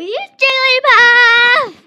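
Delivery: loud, high, drawn-out cheer; excited shout. Real, not acted. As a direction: belt it out with glee. Excited toy reveal, big shout.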